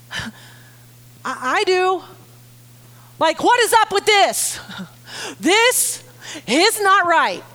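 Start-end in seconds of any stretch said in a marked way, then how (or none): 3.2-7.4 higher indignant "what is up". Retold or imitated. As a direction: act the outrage yourself, pitch rising. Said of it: "Do you hear her angst? I do".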